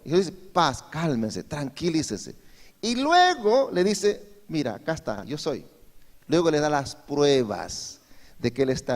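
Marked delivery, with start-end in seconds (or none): 0.5-2.3 soft, calming reassurance; quietly soothing frightened friends. Acted. Jesus calming frightened disciples. 4.5-5.7 very soft, tender reveal; soft and tender, showing his hands. Acted. Jesus gently revealing himself.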